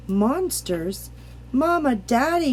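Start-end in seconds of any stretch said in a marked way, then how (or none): 1.5-2.5 put-on scared boy yelling for his parents at night. A narrator's reading. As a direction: call out for Mama and Daddy. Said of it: "yelled James".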